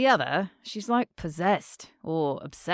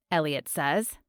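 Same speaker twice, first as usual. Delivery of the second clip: ordinary delivery, nothing marked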